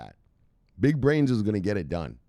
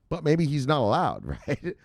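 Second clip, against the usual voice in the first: ordinary talk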